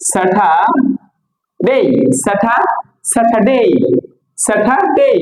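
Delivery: স্যাটারডে broken slowly into SA-tha, DAY; correct pronunciation. Shown as a demonstration. Teacher drilling for learners to repeat, correct pronunciation. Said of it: "correct pronunciation".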